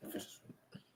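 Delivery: breathy whisper of the pick; a soft whispered echo. Real, not acted. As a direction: whisper it to yourself, savoring.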